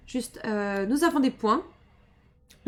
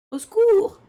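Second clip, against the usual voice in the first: loud, high panicked scream; screaming for help; 10 semitones higher, 9 dB louder, slower than usual. Pretend. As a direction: shriek it for help. Voices victim's cry for help.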